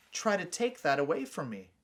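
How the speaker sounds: ordinary delivery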